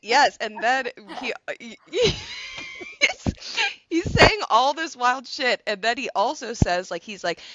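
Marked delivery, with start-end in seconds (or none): 1.8-2.9 shrieking laugh. Real, not acted. Shrieking laugh mid story.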